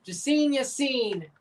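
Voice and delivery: normal talking voice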